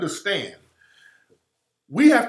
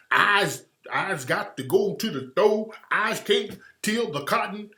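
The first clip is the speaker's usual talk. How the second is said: hammed-up Southern plantation talk; mock plantation dialect. Mocking how they wrote her speech, in plantation dialect. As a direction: parody a plantation field-hand dialect. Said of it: "all this plantation talk".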